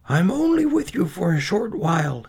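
high, strained father's voice; doomed man saying goodbye, pitched high; sorrowful farewell. A narrator's reading. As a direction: break bad news to your children. Doomed father bids children farewell.

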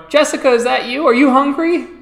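high, loud call from the kitchen; father calling. A narrator's reading. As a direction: call out to your daughter as Dad. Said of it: "the kitchen, your father going".

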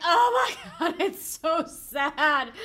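high, loud wail with a dragged-out finish; hammed-up distress. Host relives their reaction to the film, comic wailing. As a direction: wail it half laughing, stretching the end.

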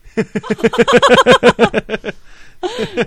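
loud, high burst of laughter; helpless high-pitched laughing fit; real laugh. Real, not acted. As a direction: crack up loudly at a prank, high and long. Reacting to played prank clip.

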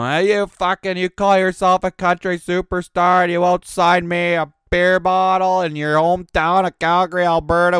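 loud, high mocking jeers; drunken taunting. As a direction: heckle him like a drunk fan. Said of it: "I was chirping him".

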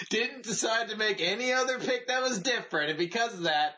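high, singsong taunting announcement; hammed-up teasing results announcement; gloating mock proclamation. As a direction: proclaim his defeat with relish, laughter creeping in. Mock proclamation of friend's lost bet.

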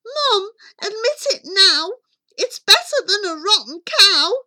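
put-on high, loud boy's voice; boy crying out. A narrator's reading. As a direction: cry out eagerly as young Jack to his mother. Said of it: "Young Jack cried".

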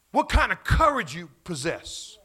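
ordinary delivery

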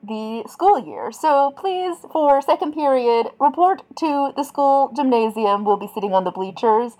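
principal announcing on the PA; over the intercom. Acted. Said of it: "beeping that comes over the intercom".

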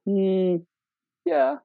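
high, reluctant hum then yeah; imitated wife's doubtful little voice; hesitant squeak. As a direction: squeak out a yes that means no. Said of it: "the squeak".

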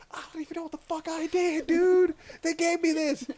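put-on high, amazed child's voice; high-pitched giddy impression; excited kid. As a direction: imitate a kid thrilled and baffled. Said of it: "he was so excited".

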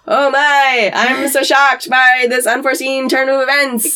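mock shocked, high and loud; hammed-up fake surprise; sarcastic. Sarcastic mock shock, demon voiced.

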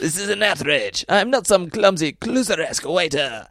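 high, offended French waiter; indignant French waiter. Retold or imitated. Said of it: "doing the waiter".

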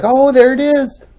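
high, excited squeal of wife imitated; wife spotting the video on TV, imitated excitedly; mock excited. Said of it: "all excited".

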